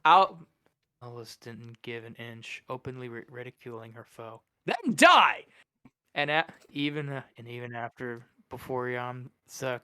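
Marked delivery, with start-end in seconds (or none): 1.0-4.4 story narration. A narrator's reading. 4.7-5.5 high, loud battle cry; furious shout. Acted. Villain shouts.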